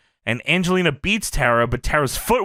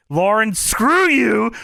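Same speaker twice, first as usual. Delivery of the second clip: mock outburst; 7 semitones higher, 4 dB louder, much slower than usual. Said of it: "and she says".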